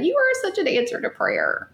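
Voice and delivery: sung-out high, adoring praise; doting mother praising her son; tender praise. Retold or imitated. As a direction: lift the voice up high in fond wonder. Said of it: "touch him, and just say".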